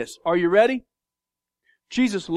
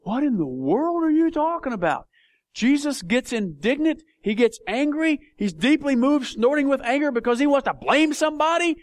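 high-pitched disbelief; incredulous; 5 semitones higher than usual. Acted. Preacher voicing shock at an angry Jesus, incredulous. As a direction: protest it as if it makes no sense. Voices shocked reaction to the translation.

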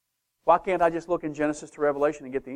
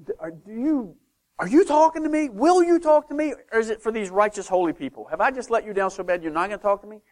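acted-out anxious prayer, higher than usual; desperate pleading; 5 semitones higher than usual. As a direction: beg God for an answer, voice pitched up. Acts out desperate prayer to God.

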